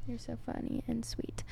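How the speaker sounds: soft affectionate whisper. Real, not acted. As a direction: whisper it sweetly to him. Whispered affectionate remark to partner.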